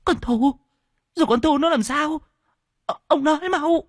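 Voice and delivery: high, frantic, pleading; a mother terrified for her daughter; panicked wife. A narrator's reading. Wife begs husband to tell what happened to Thu, panicked high voice. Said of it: "nước mắt cũng tuôn trào".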